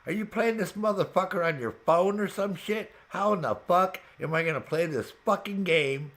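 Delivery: high-pitched, incredulous retold tirade; exasperated rant. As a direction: rant at a friend in mock outrage. Retelling angry rant at Renee.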